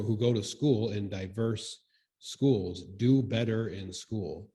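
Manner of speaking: ordinary talk, nothing marked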